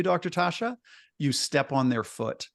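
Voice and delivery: ordinary talk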